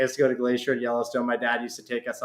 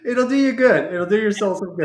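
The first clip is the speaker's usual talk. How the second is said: laughing; 8 semitones higher, 5 dB louder than usual. Real, not acted. Laughing agreement after joke.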